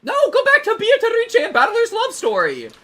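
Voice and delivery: very high, loud whining; whiny plea. Real, not acted.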